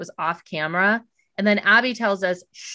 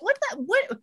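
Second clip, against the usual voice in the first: baffled; 14 semitones higher than usual. Retold or imitated. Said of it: "I'm like".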